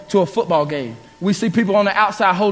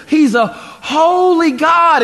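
slow, high and loud, each word weighed; emphatic proclamation; 7 semitones higher, 4 dB louder, much slower than usual. Real, not acted. Preacher proclaiming God's holiness to the church, slow and high. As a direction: lean hard into holy God.

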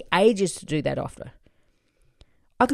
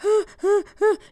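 high, loud hyperventilating gasps; 5 dB louder than usual. Retold or imitated. Author acting out nerves before publishing, hyperventilating gasps. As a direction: gasp in and out in mock panic. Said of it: "like, hyperventilating".

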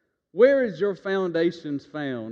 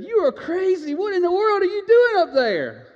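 scolding wife; 13 semitones higher, 6 dB louder than usual. Retold or imitated. Wife scolds husband on roof.

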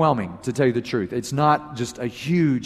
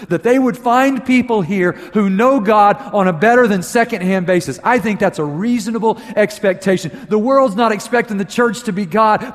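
raised, driving preacher's voice; full preaching; 7 semitones higher, 6 dB louder than usual. Acted. Pastor challenging his congregation, full force. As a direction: press the challenge home to the church.

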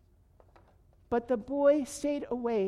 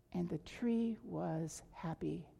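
plain reading voice

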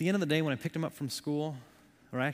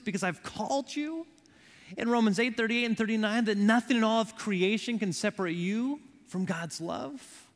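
high, impassioned sermon delivery; urgent preacher with raised pitch; full-force preaching; 6 semitones higher, 4 dB louder than usual. Real, not acted. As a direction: press each question and promise on the congregation.

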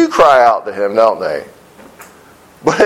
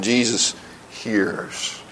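much softer, slow "Jesus hears", almost hushed; soft repeat; 6 semitones lower, 10 dB quieter, much slower than usual. Shown as a demonstration. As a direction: drop the voice right down and let "hears" fade.

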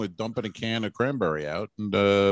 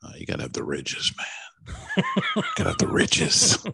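laughing. Real, not acted. Laughing joke.

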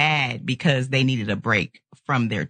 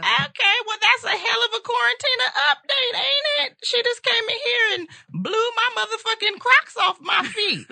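excited shrieking. Real, not acted. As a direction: shriek in stunned delight. Shocked by daughter's news.

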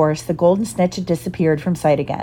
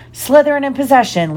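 higher, louder sports call; commentator; 7 semitones higher, 4 dB louder than usual. A narrator's reading. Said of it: "Lee Jordan was saying".

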